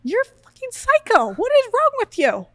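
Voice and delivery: hammed-up outraged husband; imitating a fed-up partner shouting; mock exasperated yell. Husband reacting to screaming wife.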